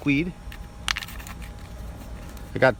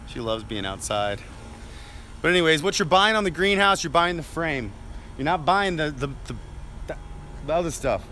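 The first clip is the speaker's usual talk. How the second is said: ordinary talk, nothing marked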